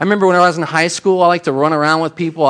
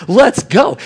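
higher, louder eager let's go; excited let's go; 8 semitones higher, 5 dB louder than usual. Retold or imitated. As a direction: egg your friends on, keen for trouble.

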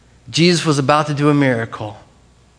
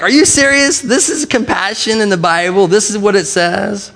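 high-pitched, incredulous questioning; acted disbelief; 4 semitones higher than usual. Preacher reacting to a long Greek word, incredulous. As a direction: play up baffled disbelief at the word. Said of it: "I'm like".

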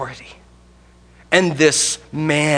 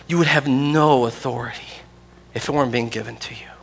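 whispered Jesus reply; 3 semitones lower, 6 dB quieter than usual. Acted. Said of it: "Jesus just says".

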